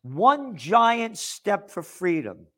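headline read slow, giant stressed; mock headline. Host mocking Drudge headline, slow and grand. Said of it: "this is the headline".

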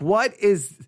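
ordinary delivery

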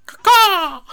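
shrill, very loud crow caw; crow call. Pretend. Hammed-up crow call summoning Al into the room.

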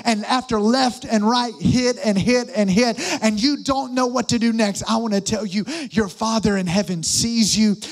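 rally the room at full force; preaching. Acted.

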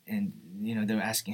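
ordinary talk, nothing marked

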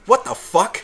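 high-pitched, baffled retort; retold startled disbelief; incredulous reaction. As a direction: act out being thrown by it. Retelling his startled reaction.

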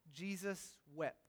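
soft, slow reverent reading; hushed solemn reading. Real, not acted. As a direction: let the verse land gently. Reading the shortest verse.

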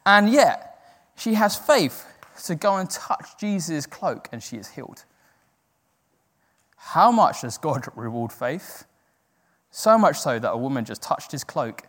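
ordinary talk, nothing marked

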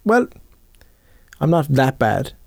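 ordinary delivery

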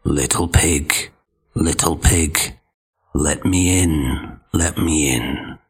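low, slow wolf voice; big bad wolf calling sweetly. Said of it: "calling out".

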